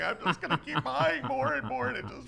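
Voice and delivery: mock-ecstatic customer hooked on buying; blissed-out shopper. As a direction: squeal with shopping delight. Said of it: "And you're like".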